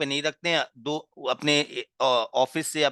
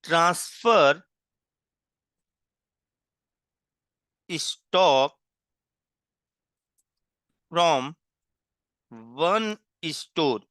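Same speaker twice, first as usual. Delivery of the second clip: slow dictation; much slower than usual. Shown as a demonstration. Teacher dictating a heading for students to copy, slow and deliberate. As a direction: dictate it word by word so students can write.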